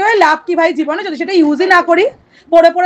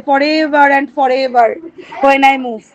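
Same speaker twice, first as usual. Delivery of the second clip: slow poem recitation; 3 semitones lower, much slower than usual. Shown as a demonstration. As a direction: recite the line again very slowly. Repeats poem line.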